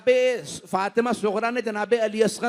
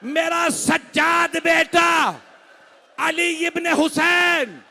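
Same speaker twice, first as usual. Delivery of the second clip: high, loud and slow grieving shout; preacher brings the mourners to the climax with loud lament; climactic lament; 9 semitones higher, 7 dB louder, slower than usual. Acted. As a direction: stretch each name out in a mourning cry. Climactic wailing call.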